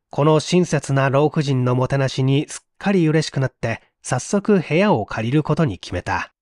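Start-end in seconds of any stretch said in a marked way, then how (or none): none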